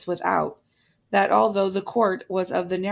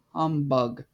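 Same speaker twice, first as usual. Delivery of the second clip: low scornful humbug; Scrooge's humbug; 5 semitones lower than usual. A narrator's reading. Scrooge scorning Christmas in a low grumble. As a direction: sneer it like an old miser. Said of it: "said Scrooge".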